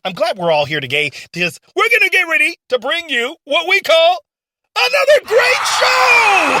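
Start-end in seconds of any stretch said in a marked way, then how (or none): 4.8-6.6 higher, louder, slow announcer cry; showman shout. Acted. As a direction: belt the catchphrase to the crowd. Host's big catchphrase.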